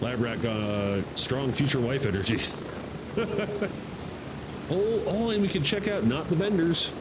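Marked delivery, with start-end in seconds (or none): 2.4-4.6 high, soft giggling; laughing. Real, not acted. Player after future wife remark, soft high laughter. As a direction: giggle at your own joke.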